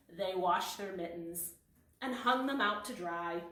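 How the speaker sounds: ordinary delivery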